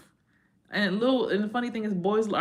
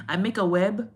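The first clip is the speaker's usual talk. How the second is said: higher, louder mocking accent; mock accent quote; 4 semitones higher, 6 dB louder than usual. Quoting viewers mocking her accent. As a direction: parrot the line mockingly.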